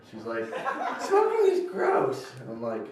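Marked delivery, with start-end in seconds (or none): 1.0-2.3 much higher mock sister voice; mock nagging sister. Sister complaining about his smoky clothes, high whine.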